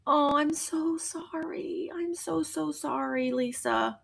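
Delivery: tender softening apology to a viewer; soft apology. Real, not acted.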